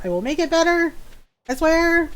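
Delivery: high-pitched mock pleading; pleading promise. Farmer swearing to upset animals, high playful pleading.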